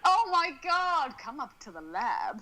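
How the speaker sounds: hammed-up camp villain, slow; camp mad scientist. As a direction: ham it up, theatrical lab host. Frank-N-Furter quip.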